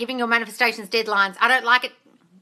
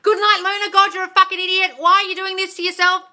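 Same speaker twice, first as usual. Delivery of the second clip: high, louder self-scolding; mock yelling; 10 semitones higher, 7 dB louder than usual. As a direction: imitate someone berating their reflection. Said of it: "like you're yelling at yourself in the mirror".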